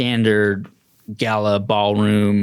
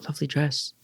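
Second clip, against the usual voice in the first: dry quiet compliment; 5 semitones higher, 8 dB quieter than usual. Acted. Attendant compliment.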